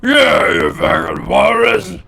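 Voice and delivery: high, slow, loud shouted insult; third giant head. Acted. Another giant head shouting at the walrus, high and slow. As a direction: bellow it high and drawn out. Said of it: "Another head goes".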